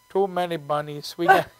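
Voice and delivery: put-on low-pitched Indian accent; friendly imitation of an Indian accent. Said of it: "I love doing that accent".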